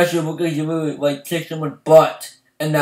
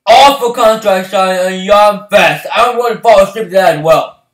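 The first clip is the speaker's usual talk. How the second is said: ordinary talk, nothing marked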